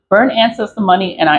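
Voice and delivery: normal talking voice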